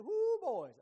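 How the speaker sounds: high, loud whooping brag; cocky showoff. Retold or imitated. Israelite man showing off his woman to the camp, loud whoop. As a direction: crow it to the boys. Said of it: "that's how I'd say it".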